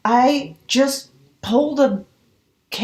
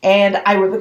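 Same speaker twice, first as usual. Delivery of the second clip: ordinary delivery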